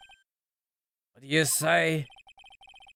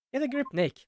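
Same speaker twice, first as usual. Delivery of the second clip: put-on a higher girl's voice, urging; Maya's voice; 8 semitones higher than usual. Assistant telling the attorney to get a grip, higher voice. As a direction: nudge him like a cheeky assistant.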